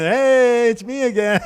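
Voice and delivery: high, drawn-out mock-friendly hello; hammed-up chummy return; fake cheery greeting. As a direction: greet as if nothing happened. Retold sheepish cheery return to cashier.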